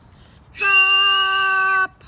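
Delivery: long, high, loud drawn-out stop; policeman's shout. Acted. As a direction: bellow it to halt the traffic. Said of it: "came running up ... blowing his whistle".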